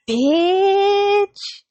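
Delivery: high, long-drawn exclamation; incredulous outburst to a friend; scandalized disbelief. Real, not acted. Exclaiming at son's nerve to friend.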